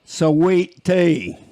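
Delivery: "Sweet tea" drawn out in a lightly mocking Southern country twang. Shown as a demonstration. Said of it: "they say sweet tea like this".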